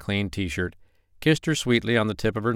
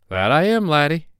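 high, loud off-the-boat Irish lilt; Irish accent; 7 semitones higher, 5 dB louder than usual. A narrator's reading. Uncle teasing his nephew back, in an Irish accent. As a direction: put on your mother's thick Irish accent. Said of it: "using his mother's off-the-boat Irish accent".

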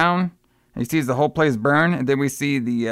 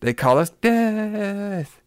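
high, drawn-out eerie last word; hammed-up witch; 6 semitones higher, much slower than usual. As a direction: stretch it out like a mock-spooky reveal. Voices witch's dramatic reveal.

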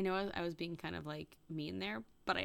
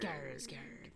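whisper; 6 semitones lower, 6 dB quieter than usual. Pretend. Whispered name joke.

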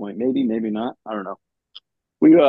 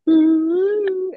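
a much higher, hammed-up ooh; mock ooh; 3 dB louder than usual. Colonel reacting to the general singing, mock ooh. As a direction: tease with a high ooh.

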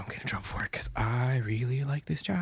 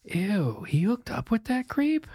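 disgusted high voice; 10 semitones higher than usual. Acted. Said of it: "be like".

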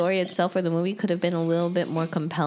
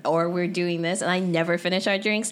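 normal talking voice

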